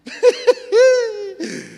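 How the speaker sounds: loud high burst of laughter; sudden high laugh. Real, not acted. Laugh after acted refusal.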